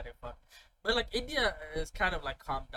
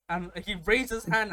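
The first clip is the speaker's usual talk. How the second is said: ordinary talk, nothing marked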